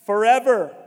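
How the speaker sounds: loud preached forever; emphatic. Acted. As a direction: land it as the big climax. Climax of Moses' promise.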